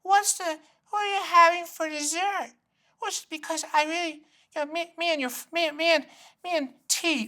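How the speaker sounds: put-on high, soft old-lady voice; mom voice. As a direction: do his elderly mom, pestering about dessert. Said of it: "she'll call beforehand and she goes".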